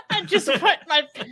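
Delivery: high, laughing too hard to finish; real laughter cutting off the words; breaking up laughing. Real, not acted. Host reading a viewer's comment aloud, cracking up.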